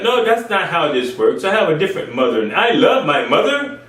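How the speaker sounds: ordinary delivery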